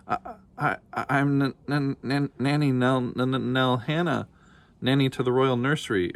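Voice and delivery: terrified stammer. Acted. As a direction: stutter out your name, scared stiff. Terrified nanny stammers before dragon.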